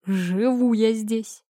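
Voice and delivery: slow reply with a hint of a chuckle; amused. A narrator's reading. Man answers the girl in bed, amused. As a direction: drawl it, mildly amused. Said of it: "ответил он со смешком".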